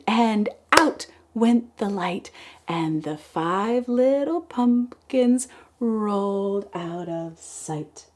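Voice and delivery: slow, sing-song poem reading; kindergarten teacher voice. Acted. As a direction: read it like circle time. Reads poem again.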